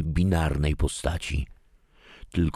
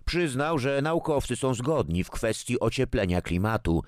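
radio news read; 7 semitones higher than usual. Acted.

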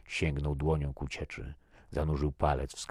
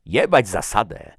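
angry defiance; 6 semitones higher, 13 dB louder than usual. A narrator's reading. Defiant retort to a reprimand.

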